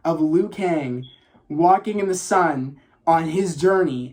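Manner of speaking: normal talking voice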